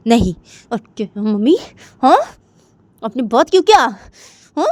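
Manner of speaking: irritated daughter. Acted. Jiya on the phone questioning her mother, irritated. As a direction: press her mother for answers.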